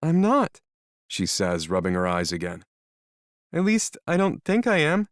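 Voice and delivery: put-on nervous young woman voice; anxious young woman. Melody worried, higher.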